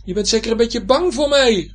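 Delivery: high, loud taunting shout; blustering high-pitched anger; angry bluster. A narrator's reading. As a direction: act tough and yell it crossly. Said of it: "al doet hij nu stoer van boosheid".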